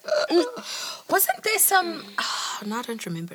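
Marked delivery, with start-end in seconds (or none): 0.0-0.6 high mock disgust grunts; mock revulsion noises; disgusted ugh. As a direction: squeal of disgust, much higher. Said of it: "people are like".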